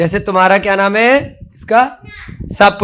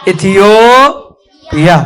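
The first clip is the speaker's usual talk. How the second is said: इथियो stretched long on the O, then पिया after a break; drawn-out model pronunciation; much slower than usual. Shown as a demonstration. Teacher models Ethiopia for the class to repeat, drawn out. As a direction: slowly lead Ethiopia, holding "yo" on a rise. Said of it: "बोलो सब बच्चे".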